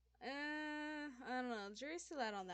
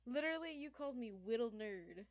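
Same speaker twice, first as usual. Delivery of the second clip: usual speaking voice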